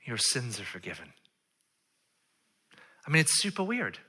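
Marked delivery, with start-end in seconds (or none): 0.0-1.1 soft, low pronouncement; hushed, sincere absolution; solemn pardon. Acted. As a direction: state it quietly and simply. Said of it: "this is what Jesus says to him".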